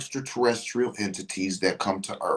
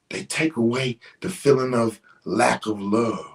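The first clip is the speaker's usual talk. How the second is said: ordinary talk, nothing marked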